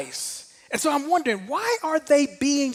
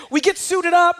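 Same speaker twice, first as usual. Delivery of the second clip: usual speaking voice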